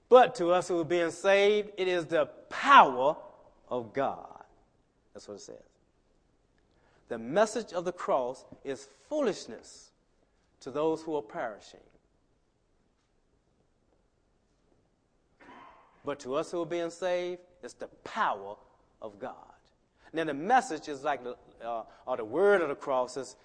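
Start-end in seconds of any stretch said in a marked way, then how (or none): 0.1-4.4 loud, high ringing scripture read; preaching at full force. Acted. Preacher booming the verse to the church. 7.1-19.3 raised preaching read of the verse. Acted. Preacher proclaiming the verse to his flock.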